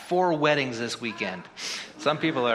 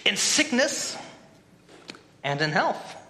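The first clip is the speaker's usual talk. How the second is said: wedding officiant; 5 semitones higher than usual. Acted. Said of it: "Repeat after me".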